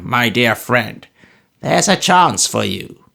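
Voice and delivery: put-on loud, high, slow scolding old man; Smallweed scolding the dragoon; raging old miser. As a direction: drive them out with spite. Narrator voices old Smallweed raging.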